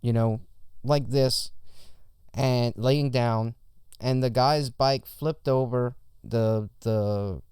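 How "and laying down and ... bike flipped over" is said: ordinary talk, nothing marked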